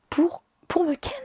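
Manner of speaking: a high, whispered scoff of disbelief; indignant whisper. Real, not acted. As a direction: spit it out in a shocked whisper. Indignant retelling of his offer.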